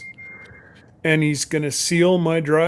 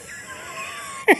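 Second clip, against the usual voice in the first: very high-pitched giggle; short squeal of laughter. Real, not acted. Host jokes about poor tech skills, squealing laugh.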